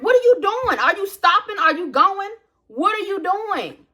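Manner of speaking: high, loud exasperated yelling at a driver. Retold or imitated. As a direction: throw the question at him, fed up.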